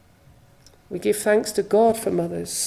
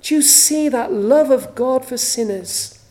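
ordinary talk, nothing marked

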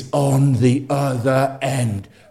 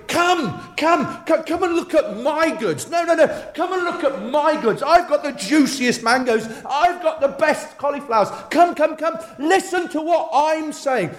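market sellers shouting; 12 semitones higher than usual. Retold or imitated. Indian market seller calling to shoppers, hawker's cry. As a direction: call out like a bazaar trader drumming up trade. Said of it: "they're shouting".

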